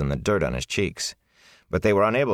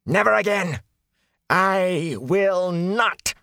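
shouting; 14 semitones higher, slower than usual. Acted. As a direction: hurl it at the ceiling. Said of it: "he shouted at the ceiling".

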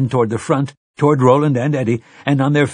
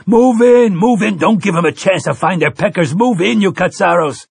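loud, high screamed orders; screaming; 9 semitones higher, 5 dB louder than usual. A narrator's reading. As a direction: scream it at your troops. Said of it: "someone was screaming".

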